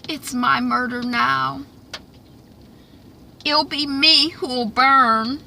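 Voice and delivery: high, slow fatalistic line; lightly voiced resigned mother; grim slow resolve. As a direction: accept the doom slowly. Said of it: "the mama says".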